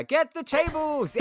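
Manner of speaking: high, loud mock wrestling yell; catchphrase shout. Streamer reading a Dudley Boyz reference, hammed-up shout.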